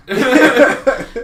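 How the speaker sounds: loud high burst of laughter. Real, not acted. Laughing at Jabba's line, loud burst of laughter. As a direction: crack up.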